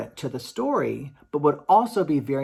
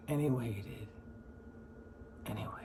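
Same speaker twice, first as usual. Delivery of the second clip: dropping much lower and softer into near silence; trailing off; 5 semitones lower, 14 dB quieter than usual. Shown as a demonstration. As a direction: let the words fade away as time drags. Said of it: "So he waited for the giant".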